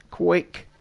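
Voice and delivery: ordinary talk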